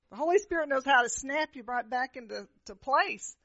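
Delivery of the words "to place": ordinary talk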